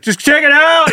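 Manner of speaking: sudden shouted command; yelling. Pretend. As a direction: bark it out mid-chew. Imagined surgeon yelled at by visitor.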